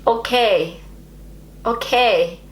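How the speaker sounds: "Okay" in an attempted German accent; German-style okay. Shown as a demonstration. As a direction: copy the German way of saying "Okay". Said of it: "Does that sound more German?".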